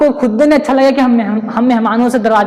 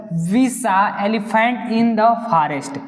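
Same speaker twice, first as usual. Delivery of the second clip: slow question read; much slower than usual. Shown as a demonstration. Reads question slowly.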